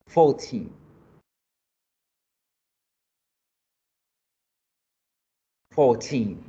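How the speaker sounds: put-on "Fourteen" as a listening test, final -teen stressed. Shown as a demonstration. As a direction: put the weight on the last syllable of FOURTEEN. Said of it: "la fuerza se hace en la última sílaba". ("Fourteen" con fuerza en -teen)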